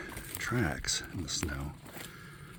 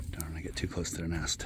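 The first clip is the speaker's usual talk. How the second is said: usual speaking voice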